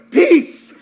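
sudden high, loud shout; called-out peace. Retold or imitated. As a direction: blurt it out loudly, high-pitched. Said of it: "I just went".

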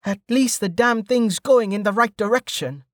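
high-pitched mild growl; growled grumble. A narrator's reading. Stint grumbling to himself about his route, growled. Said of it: "Stint growled".

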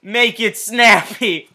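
slow, drawn-out punchline delivery; joke punchline. Acted. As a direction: lean into the punchline slowly.

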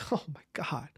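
hushed, whispered mock awe; awed whisper. Host marvels at imagined power of being rich, awed whisper. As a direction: whisper it as if overwhelmed.

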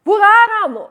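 loud, high shout; crying out. Acted. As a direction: shout it as a people's desperate call. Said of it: "snart skal de rope".

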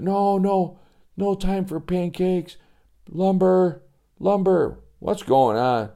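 put-on a kid too scared for pancakes; panicky boy. Joey panicking.

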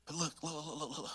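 a hushed urgent call to look. Real, not acted. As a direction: quietly draw the congregation in. Preacher pulls listeners in.

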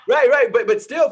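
real laugh through the words. Real, not acted. Laughing at partner's joke.

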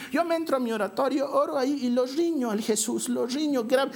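a light church-lady impression; imitating church ladies. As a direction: play a pious lady boasting. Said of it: "señoras que me dicen".

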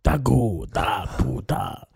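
low, slow, guttural made-up alien words; fake Klingon. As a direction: growl it like a Klingon warrior.

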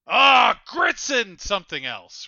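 imitated exasperation, loud burst on the "Ah"; exasperated exclamation. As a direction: blurt it out in mock frustration. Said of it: "an exasperated".

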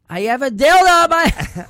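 high, full-out yell; exasperated shout. Acted. Embarrassed patient told to speak up, shouting it. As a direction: blurt the secret at top volume.

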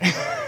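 short high laugh; quick high burst of laughter. Real, not acted. Chef amused at own oven's quirks, laughing.

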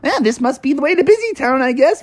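high, quick, lightly acted voice; light high-voiced cabbie; cartoon driver. Driver shrugs off the detour.

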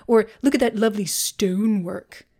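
fancy posh admiring voice; posh accent. Pretend. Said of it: "with that accent there".

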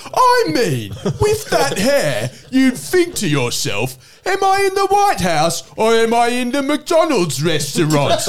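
high, slow, mock-outraged host; milk each line like a satirical news host; John Oliver impression. Comic doing a news host, high and slow, mock outrage. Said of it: "John Oliver style bit".

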